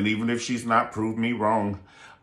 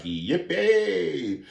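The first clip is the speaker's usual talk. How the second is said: imitating cameraman; 6 semitones higher than usual. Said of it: "That cameraman was like".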